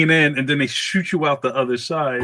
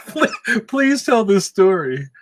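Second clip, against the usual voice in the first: high, laughing plea; words breaking up in laughter; real laughing; 8 semitones higher, slower than usual. Real, not acted. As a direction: beg through laughter. Laughing at a listener's message.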